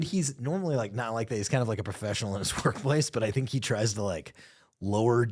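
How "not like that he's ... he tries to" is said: normal talking voice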